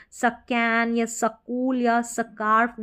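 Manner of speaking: सकैन, सकूल, स्कार्फ with a full "sa" syllable before the k; wrong full-S pronunciation. Shown as a demonstration. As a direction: split them slowly as sa-kain, sa-kool, sa-kaarf. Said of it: "नहीं कह सकते हैं".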